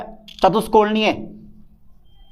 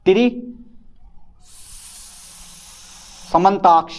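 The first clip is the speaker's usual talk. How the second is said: slow drill; much slower than usual. Shown as a demonstration. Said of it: "drilling the term".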